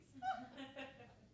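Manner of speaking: light amused giggle; girlish laugh. Acted. Old lady giggling at her dream, light laugh.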